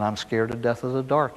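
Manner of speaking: low, softened confession, no real sobs; retold sobbing child. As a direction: quietly own up like an upset child, understated. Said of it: "the kid was just sobbing by now".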